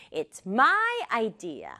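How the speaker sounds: slow line leaning on my; stress on my. Shown as a demonstration. Teacher models the girl's claim, stressing my. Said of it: "강조를 했기 때문에".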